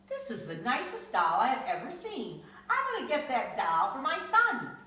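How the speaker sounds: delighted at a find; eager shopper. Acted. Man spotting the doll for his son, eager pleased voice.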